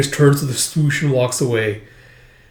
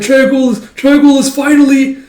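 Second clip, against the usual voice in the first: much higher and louder, bursting with glee; excited cheer; 12 semitones higher, 8 dB louder than usual. Retold or imitated. Soldiers cheering over the enemy's death, high excited yell. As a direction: whoop it out like the fight is won.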